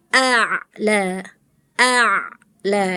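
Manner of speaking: A'LA with the throat sound drawn out; flowing ain. Shown as a demonstration. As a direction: let A' flow slightly before LA. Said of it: "Ngalirin dulu sedikit".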